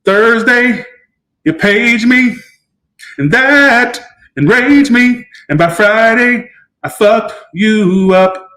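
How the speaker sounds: high, slow, heavy dramatic reading; mock dramatic recital; dramatic message read. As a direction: read it like a grand threat.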